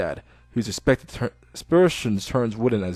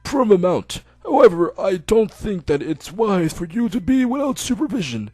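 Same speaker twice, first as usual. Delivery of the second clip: put-on old doctor; 6 semitones higher than usual. Old doctor telling Hisao he can go home.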